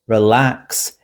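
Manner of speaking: "relax" with stress on LAX; re-LAX, first syllable left weak; stress on second syllable. Shown as a demonstration. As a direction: lean on the end of "relax". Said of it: "we stress the second syllable".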